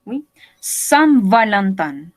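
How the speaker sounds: normal talking voice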